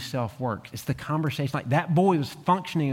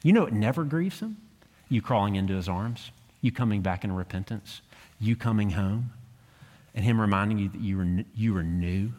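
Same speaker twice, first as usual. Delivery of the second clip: ordinary talk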